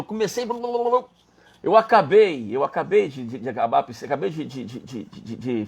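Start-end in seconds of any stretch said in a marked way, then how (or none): 0.4-1.0 high babbled "blah blah"; imitated rush of hurried work; frantic babble. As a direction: gabble nonsense syllables in a hurry. Mimics his frantic working.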